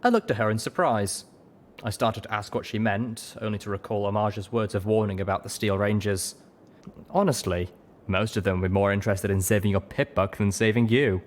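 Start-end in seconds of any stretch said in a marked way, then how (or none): none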